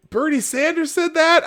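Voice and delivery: very high, loud mock disbelief; incredulous reaction. Host redoing everyone's reaction to the story, incredulous. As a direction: squeak the question in hammed-up doubt. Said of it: "Everyone's like".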